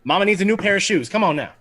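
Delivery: quick, high, loud plea to the dice; gambler's urging. Pretend. Game master rooting for the attack roll, gambler's urging.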